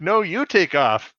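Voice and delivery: mock Canadian comeback; Canadian accent. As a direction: throw it back at him slowly. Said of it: "my Canadian voice guy".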